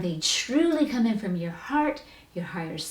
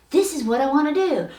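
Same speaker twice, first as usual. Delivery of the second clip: higher and louder, fired up; a touch of eager resolve; 7 semitones higher, 5 dB louder than usual. Pretend. Listener writing first baby step, eager declaration. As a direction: declare it like a fresh commitment.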